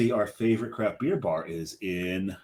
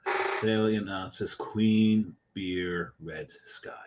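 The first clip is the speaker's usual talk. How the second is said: slow reading; 3 semitones lower, 7 dB quieter, much slower than usual. Shown as a demonstration. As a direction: read it very slowly. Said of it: "you read".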